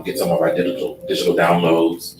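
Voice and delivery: usual speaking voice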